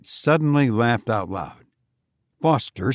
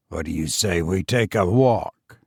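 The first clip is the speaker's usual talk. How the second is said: Fenwick's voice; 5 semitones lower than usual. Acted. Fenwick suggests a walk to Foster, low and subdued. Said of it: "he suggested".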